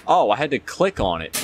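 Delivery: normal talking voice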